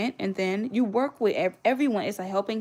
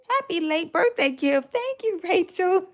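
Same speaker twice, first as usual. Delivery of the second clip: high, louder, slow thank you; gushing thanks; 10 semitones higher, 4 dB louder than usual. Real, not acted. Thanking Rachel for the birthday gift, high delighted thanks.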